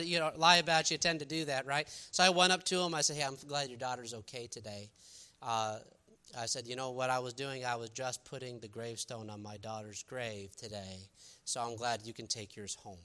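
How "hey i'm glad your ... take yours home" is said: hushed, steady, holding back grief; quiet and heartfelt. Retold or imitated. To the lying father, quiet grieving sincerity. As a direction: speak low to the father, gently. Telling liar about daughter's grave.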